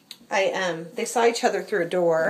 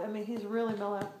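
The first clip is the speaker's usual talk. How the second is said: usual speaking voice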